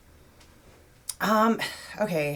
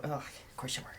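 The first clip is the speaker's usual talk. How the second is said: whispered tease; 4 semitones lower, 15 dB quieter than usual. Real, not acted. Teasing aside about metal phase.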